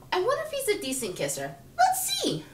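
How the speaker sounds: high, coy inner thought; playful curiosity. Acted. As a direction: rise to a squeaky, teasing high finish. Acts out thoughts before a kiss.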